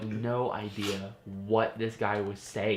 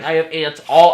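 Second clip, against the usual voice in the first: much louder rising panic; panicked yell; 5 semitones higher, 14 dB louder than usual. Retold or imitated. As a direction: start to freak out. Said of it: "I was like".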